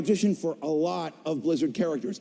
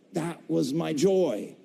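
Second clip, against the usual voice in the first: ordinary talk, nothing marked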